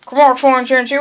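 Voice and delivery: ordinary delivery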